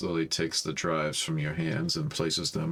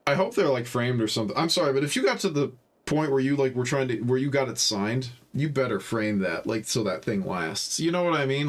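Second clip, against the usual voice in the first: higher and louder, tumbling fast in delight; excited gushing; 7 semitones higher, 3 dB louder than usual. Real, not acted. Player marvelling at signed band posters, excited and loud. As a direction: gush like a fan who can't believe it.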